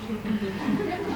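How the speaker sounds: a short amused chuckle; light chuckle. Real, not acted. Narrator chuckling at the story, a short soft chuckle. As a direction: laugh softly at the line.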